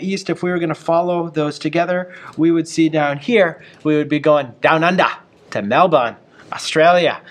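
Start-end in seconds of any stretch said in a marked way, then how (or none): 3.8-7.2 a light, playful put-on Australian accent; mock Australian accent. Teacher jokingly points out Melbourne in a mock Australian accent. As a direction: slip into a hammed-up Australian accent for the place names. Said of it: "Sorry about my Australian accent".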